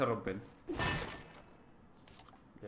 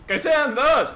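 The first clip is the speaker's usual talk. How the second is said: loud toast; 9 semitones higher, 9 dB louder, slower than usual. Real, not acted. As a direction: shout it up high and loud. Brindisi con gli alleati nel gioco.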